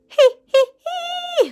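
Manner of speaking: very high squeaky hee hee; giggling wheeze; wheezing laugh. Acted. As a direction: wheeze it out helpless. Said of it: "wheezed the dragon".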